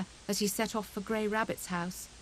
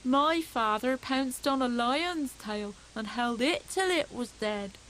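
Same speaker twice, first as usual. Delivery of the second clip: slow, higher boastful hedgehog; scornful cousin; 4 semitones higher, slower than usual. Acted. Cousin Bill outboasting Fuzzy Peg, slow and scornful. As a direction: top his story, scornful and slow. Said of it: "said Bill scornfully".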